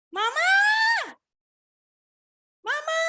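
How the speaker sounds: high-pitched call rising at the end; child yelling for mother. Retold or imitated. Preacher's child shouting up for mother from downstairs, imitated high yell. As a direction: holler up to mum from downstairs. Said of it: "teriak-teriak di dari bawah sini".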